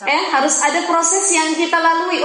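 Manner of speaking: ordinary talk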